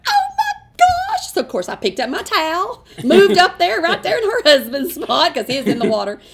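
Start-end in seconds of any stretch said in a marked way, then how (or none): 0.1-1.3 very high, slow, crying-out oh my gosh; thrilled gasp. Retold or imitated. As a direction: squeal it in disbelief. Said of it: "And I went".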